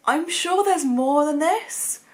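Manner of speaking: usual speaking voice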